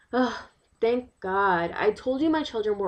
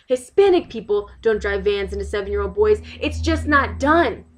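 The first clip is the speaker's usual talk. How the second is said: loud, emphatic protest; outraged insistence; 3 semitones higher, 9 dB louder than usual. Acted. Resident insists nobody drives vans into boys on purpose, outraged. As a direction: hammer the point home, exasperated. Acted outrage over accusation.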